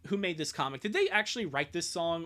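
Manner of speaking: ordinary talk, nothing marked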